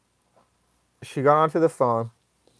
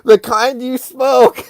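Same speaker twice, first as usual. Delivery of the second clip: high, loud, slow through laughter; real giggling repeat; laughing; 9 semitones higher, 7 dB louder, slower than usual. Real, not acted. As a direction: crack up while quoting yourself. Cracking up repeating own answer.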